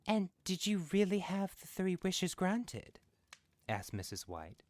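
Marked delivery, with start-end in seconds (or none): 0.1-3.0 high, louder eager asking; eager question. A narrator's reading. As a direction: ask breathlessly.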